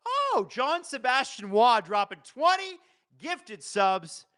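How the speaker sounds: thrilled at a big gift drop; excited. Real, not acted. Streamer thanks a viewer for twenty gifted subs, excited.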